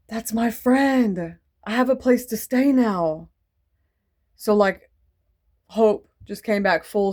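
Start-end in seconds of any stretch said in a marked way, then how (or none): 0.1-3.3 relieved friend. Retold or imitated. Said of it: "and she goes".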